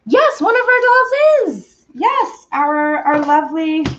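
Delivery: very high, loud, drawn-out sing-song; high-pitched parent talk. Real, not acted. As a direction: coo high and slow to a little one. Talking to interrupting child.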